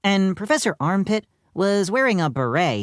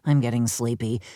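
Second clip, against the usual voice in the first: ordinary delivery, nothing marked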